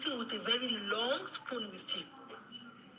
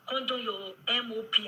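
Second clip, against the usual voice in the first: normal talking voice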